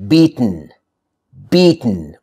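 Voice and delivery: beaten as beet-n. Shown as a demonstration. Teacher demonstrating the rule, beaten with bare N ending. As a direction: say beaten with T straight into N. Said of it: "just say the t sound".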